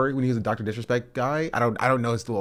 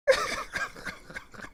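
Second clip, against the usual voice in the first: high breathless laugh; 6 dB quieter than usual. Real, not acted. Laughing at doxxing clip.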